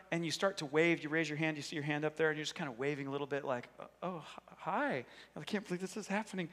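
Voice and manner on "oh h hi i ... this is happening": soft, halting shy hello, then rushed aside; timid greeting. Acted. Shy admirer waving a little bit at the crush, timid greeting. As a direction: act bashful and keep it small.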